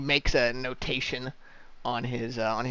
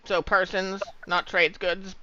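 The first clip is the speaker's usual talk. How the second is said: dwarf clerk; 7 semitones higher, slower than usual. Acted. Dwarf questions Thane.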